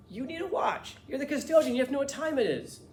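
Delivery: high, insistent, excited imitation; Andy. Friend handing the custodian his watch, excited and insistent. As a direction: press the point with loud, rising urgency. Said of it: "when he got really excited".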